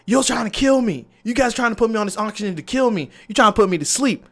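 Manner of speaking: high, protesting retold panic; mock frantic accusation, pitched high; imitated freak-out. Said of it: "she starts freaking out".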